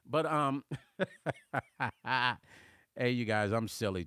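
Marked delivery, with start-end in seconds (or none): 1.0-2.4 real giggly chuckle; laughing. Real, not acted. Host amused by his own silly accent, laughing. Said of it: "I love laughing".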